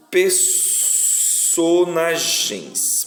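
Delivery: PERSONAGENS stretched out slowly, piece by piece; teacher slowly sounding out "personagens" by its syllables; sounded out slowly. Shown as a demonstration. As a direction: draw out each syllable of "personagens", per, so, na, gens. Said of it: "quantas vezes eu abro a boca pra falar personagem".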